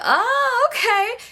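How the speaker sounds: high, drawn-up oh-okay; high-pitched mock politeness; mimicked reaction. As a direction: react way up high, sizing up the newcomer. Voicing the reaction to introduction.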